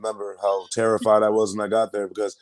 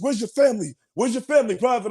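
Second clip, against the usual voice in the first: high-pitched imitated sergeant's questioning; Drill Sergeant Walsh; 12 semitones higher than usual. Drill sergeant questioning a crying private, imitated insistent demand. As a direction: press the private for an answer. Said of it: "Drill Sergeant Walsh comes up to me".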